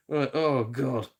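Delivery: ordinary talk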